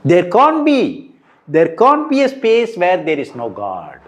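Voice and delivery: CAN'T pushed high, with a slow build; forceful Shankara-style insistence; emphatic. Retold or imitated. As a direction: insist that there can't be, rising and slowing. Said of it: "So he's so emphatic".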